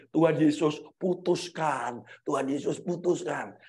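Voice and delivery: low, soft, slowed pleading prayer; retold prayer. Parents praying for a breakup, low hushed plea. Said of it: "Kami berdua doanya gini".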